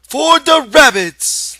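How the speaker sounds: high, loud and slow shout of delight; excited shout. A narrator's reading. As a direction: shout it out like a thrilled child. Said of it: "Lenny shouted".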